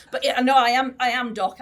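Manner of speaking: loud, laughing protest; laughing reply. Retold or imitated. Patient Steve laughing as he answers his doc, loud and sheepish. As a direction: blurt it out through laughter, caught out. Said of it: "he says".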